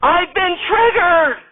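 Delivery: very high, slow hammed-up shriek; scream. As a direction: wail it like she's wounded. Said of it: "Radner screams".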